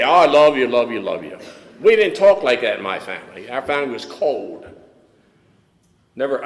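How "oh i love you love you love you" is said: loud, hammed-up effusive love-fest; mock gushing. AA members declaring love at meetings, mock gushing. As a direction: rattle off mock affection at full volume.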